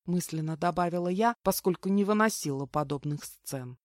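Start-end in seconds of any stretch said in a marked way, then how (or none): none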